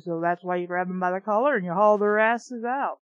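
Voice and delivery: plain reading voice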